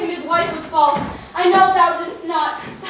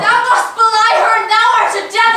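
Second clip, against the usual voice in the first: loud, fast, furious; furious accusation; 4 semitones higher, 6 dB louder than usual. Acted. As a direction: hurl it at him. Emilia accusing Othello.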